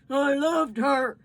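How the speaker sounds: slow, heavy grieving words. Acted. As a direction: hold back the tears. Father weeping over dead daughter.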